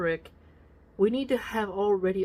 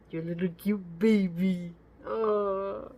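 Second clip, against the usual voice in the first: cooing; slower than usual. Real, not acted. Cooing over a baby.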